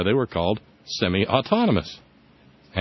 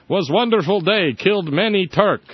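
higher, broken-English mock Kurdish accent; clumsy put-on Kurdish accent; 6 semitones higher, 4 dB louder than usual. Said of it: "I don't know if that's a Kurdish accent".

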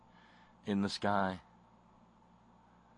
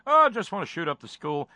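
higher, giggling breezy reply; laughing kid; 7 semitones higher, 5 dB louder, faster than usual. Acted. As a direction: say it through a laugh, carefree. Son answers laughing in skit.

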